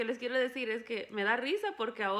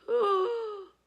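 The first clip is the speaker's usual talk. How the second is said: laughing gasp; 11 semitones higher, 9 dB louder than usual. Retold or imitated. Gasps recalling the boy's confession.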